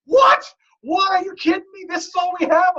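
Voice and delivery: high, loud incredulous outburst; upset friend. Retold or imitated. Friend griping his team is too small, high and incredulous. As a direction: squeal it in disbelief. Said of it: "He's like".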